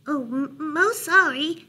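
high, slow little mouse apology; apologetic mouse. A narrator's reading. Mouse apologizing to Bear, high and hesitant. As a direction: stumble into a high-voiced apology.

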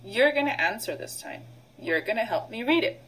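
ordinary talk, nothing marked

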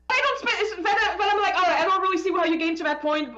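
high, loud, fast mock exasperation; flustered. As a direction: blurt it out flustered. Flustered creator quoting own reaction.

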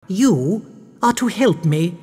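high-pitched, lightly imperious order; commanding baroness. A narrator's reading. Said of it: "the Baroness commanded".

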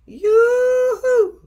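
high, loud sing-song call of grannies at the door; two grannies calling cheerfully from the doorway; sing-song yoo-hoo call. A narrator's reading. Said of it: "they said".

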